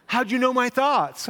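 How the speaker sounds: high, loud hammed-up surprise; mock shocked. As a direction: act stunned your mind was read. Acted coworker reacting.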